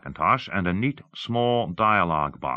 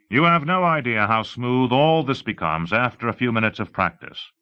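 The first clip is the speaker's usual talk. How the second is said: higher, louder pitch to an audience; lightly voiced demonstrator; 6 semitones higher, 5 dB louder than usual. Showing how smooth dictation becomes, emphatic presenter. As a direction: sell it with emphasis. Story figure pitching the software.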